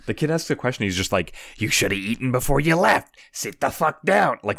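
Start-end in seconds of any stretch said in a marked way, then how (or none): 1.6-4.4 raised-pitch hammed-up parental telling-off; mock angry scolding. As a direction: scold a child as an over-the-top furious dad. Acting Jack scolding the kid.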